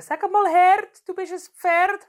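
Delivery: put-on high, slow sing-song child. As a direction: imitate a kid's teasing rhyme. Teacher quotes children's teasing rhyme.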